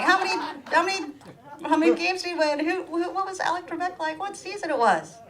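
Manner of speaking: high, fast eager questions; eager fans. Retold or imitated. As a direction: pepper her like starstruck fans. People quizzing about Jeopardy.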